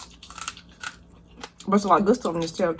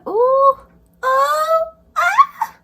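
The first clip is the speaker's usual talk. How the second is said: delighted exclamation; 6 dB louder, much slower than usual. Real, not acted. Delight over tender meat.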